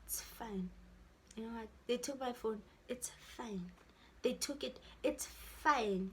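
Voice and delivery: resigned murmur to herself after crying. Retold or imitated. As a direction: give up quietly, it's fine. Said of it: "And then I'm like".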